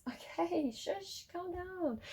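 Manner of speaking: high, soft shushing to a restless pet; pet talk. Real, not acted. Reader shushing her noisy pet off camera, high and soft.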